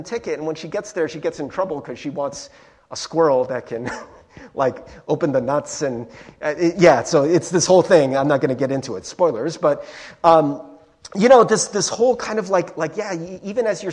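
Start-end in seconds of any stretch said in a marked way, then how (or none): none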